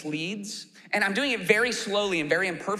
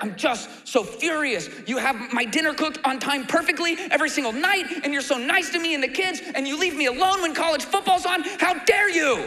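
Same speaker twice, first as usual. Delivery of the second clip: rising ever higher and louder to a squealing How dare you; mock furious husband; 8 semitones higher, 3 dB louder than usual. Husband picking a fight with his wife, mock outrage building. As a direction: ham up outrage over nothing, climbing as it goes. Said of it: "in a fight with your wife where you're like".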